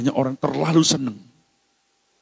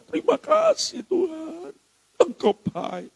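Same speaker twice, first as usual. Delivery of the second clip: high, slow, acted tearful thanks; joyful weeping; slower than usual. Believer thanking God after being touched, acting joyful weeping. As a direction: play being moved to happy tears. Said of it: "Nangis sukacita".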